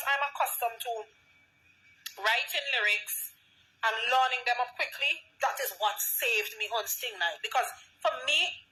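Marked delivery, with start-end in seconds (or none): none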